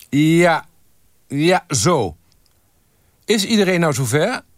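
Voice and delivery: high-pitched shouted approval and check; calling out. A narrator's reading. Photographer checking that the staff are ready, calling out. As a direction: call across to the whole group.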